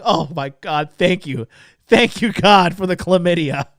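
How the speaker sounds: hammed-up relieved gratitude; grateful relief. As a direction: thank heaven with mock fervor. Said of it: "had I been so grateful".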